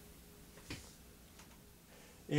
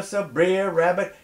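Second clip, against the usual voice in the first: plain reading voice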